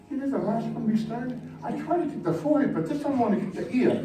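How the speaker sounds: high, fast boasting, a laugh in it; gleeful Pharisee. Acted. Pharisee boasting about hitting the ear, fast and gleeful. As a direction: brag gleefully about the throw.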